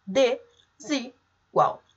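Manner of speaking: "Desigual" broken slowly into de, si, gual; split into syllables. Shown as a demonstration. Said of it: "A gente vai separar como se não fosse um prefixo".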